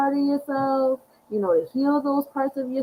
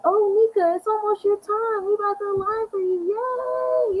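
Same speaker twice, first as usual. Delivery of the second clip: much higher, sing-song cheer from the planets; cheery planets voice; 12 semitones higher than usual. Acted. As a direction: play the planets gushing over her birthday. Voicing the planets cheering her.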